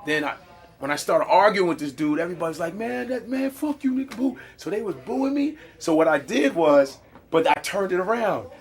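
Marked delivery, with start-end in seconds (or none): 2.7-4.4 lightly mocked higher-pitched heckling; mild retold jeer; jeering crowd member. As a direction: imitate a disgruntled audience. Retelling crowd turning on him.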